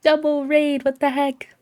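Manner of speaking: high, loud excited outburst; thrilled double-raid reaction; excited disbelief. Real, not acted.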